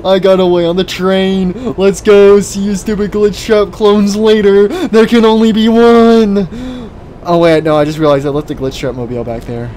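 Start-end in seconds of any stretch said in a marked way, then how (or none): 0.1-6.5 high, loud victory shouts; triumphant yelling. Real, not acted. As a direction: gloat as you escape. Escapes on train, triumphant.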